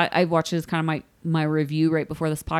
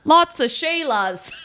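slow, higher hammed-up Aussie; mock Australian accent; 8 semitones higher, slower than usual. Host retries the flower guy's line, mock Australian accent. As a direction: draw it out in fake Australian. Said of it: "There's an Australian listening right now that is horrified".

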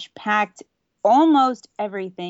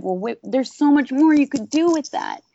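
higher-pitched, mildly exasperated protest; frustrated protest; 6 semitones higher, 3 dB louder than usual. Retold or imitated. Disappointed fan reacting to a rushed episode, frustrated protest. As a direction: quote your own let-down reaction, pleading for more.

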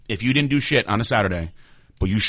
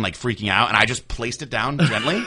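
normal talking voice

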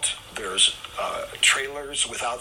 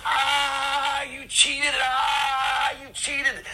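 high, loud yelling in pain; Tony yelling in pain at Paul, high and loud; pained shout; 14 semitones higher, 5 dB louder, much slower than usual. Retold or imitated. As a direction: cry out high and loud that he was cheated. Tony yelling after ear bitten.

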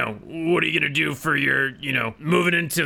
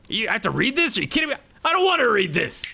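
rising, higher-pitched disbelief; indignant protest; 5 semitones higher, much faster than usual. Acted. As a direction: balk at it, getting quicker. Protesting the forced concession speech.

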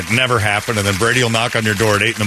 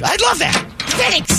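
put-on kid voice; slower than usual. Kid accepting an invitation.

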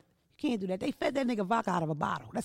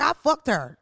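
mock brag; 4 semitones higher, 5 dB louder than usual. Imagined famous man bragging.

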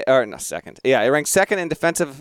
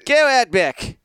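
high put-on Australian accent; a jokey Australian voice; mock Australian accent; 10 semitones higher, 4 dB louder than usual. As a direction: hand over the floor like an Aussie. Keeps Australian voice.

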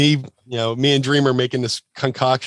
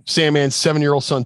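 ordinary delivery

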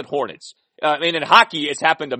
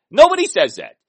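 higher, louder, stress on Nobody; emphatic insistence; 11 semitones higher, 8 dB louder than usual. Real, not acted. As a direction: drive home that nobody says it.

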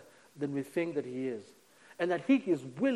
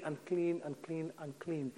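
murmured leper cry; 10 dB quieter, slower than usual. Retold or imitated. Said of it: "their way of just saying".

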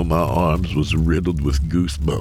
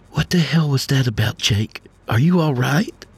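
worried wife; 11 semitones higher than usual. Acted. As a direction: press him, alarmed and caring. Worried wife confronting husband.

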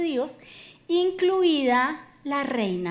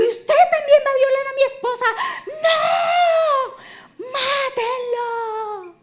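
hammed-up high, loud outrage; comic neurotic screaming, very high; hysterical king; 12 semitones higher, 7 dB louder, slower than usual. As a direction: shriek the accusation, then stretch out the order. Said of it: "porque estaba histérico".

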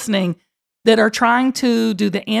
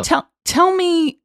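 eager shout; 7 semitones higher, slower than usual. Real, not acted. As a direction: call out eagerly. Eager plea to guest.

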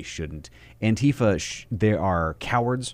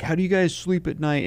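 jeering protester, pitched way up; mock protester; 11 semitones higher than usual. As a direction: throw a high mocking jab at the cops. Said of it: "you're hearing people like".

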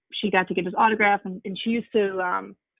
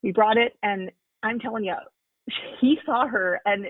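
normal talking voice

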